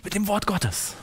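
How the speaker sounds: insistent preacher; preaching. Acted. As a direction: drive the point home to the congregation. Preaches with rising intensity.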